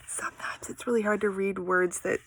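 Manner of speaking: soft whisper to the viewer; whispered aside. Real, not acted. Teacher to viewers, whispering about hard words. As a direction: confide it under your breath.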